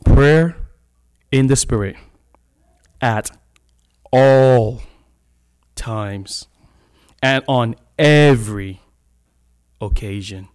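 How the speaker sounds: loud, slow and deliberate, each word hit; emphatic scripture reading. Acted. Preacher reading the verse to his church, loud and emphatic.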